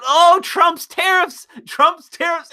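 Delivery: high, slow, mocking whine; mock panic. Streamer mocking critics who panic over tariffs, whining high. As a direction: squeal it like a hysterical critic. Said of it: "people are, like, freaking out".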